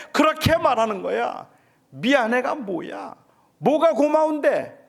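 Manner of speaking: loud, high, pleading cries; shouting. Retold or imitated. Father crying out to his son, loud and pleading. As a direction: cry it out at him, slow and heartbroken. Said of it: "저도 모르게 그냥 소리를 질렀어요".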